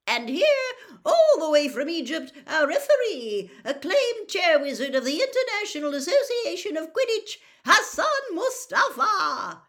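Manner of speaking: high, loud booming announcer voice; stadium announcer. A narrator's reading. Announcer introducing referee.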